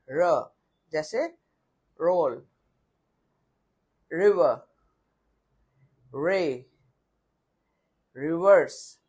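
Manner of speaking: American accent, tongue curled on रोल; American rolled R. Shown as a demonstration. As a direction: curl the r in रिवर and रिवर्स, American style. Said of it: "American accent में".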